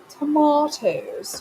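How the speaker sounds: "Tomatoes" said slow as tuh-MAH-toes; mock-fancy "Tomatoes" with a long ah; posh tomatoes. Shown as a demonstration. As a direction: draw out "Tomatoes" with the broad ah. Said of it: "You got to say it appropriately".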